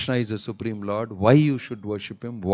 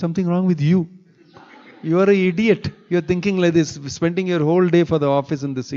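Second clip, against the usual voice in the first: raised, heated voice, higher and louder; retorting to the boss who called him wrong, raised and heated; angry retort; 5 semitones higher, 4 dB louder, faster than usual. Retold or imitated. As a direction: snap back hotly at the boss. Retold argument with his boss.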